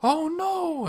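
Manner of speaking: a very high cry of alarm; high mock alarm. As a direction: yelp in fright. Waking to a worm.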